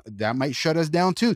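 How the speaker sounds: normal talking voice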